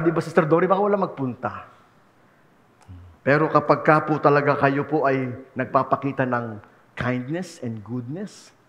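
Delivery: normal talking voice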